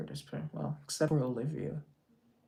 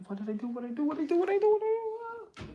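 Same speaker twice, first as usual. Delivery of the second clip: each repeat climbs higher until a squeal; climbing from normal to squealing high; rising mock panic; much faster than usual. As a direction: escalate the question into a high comic wail. Repeating question rising each time.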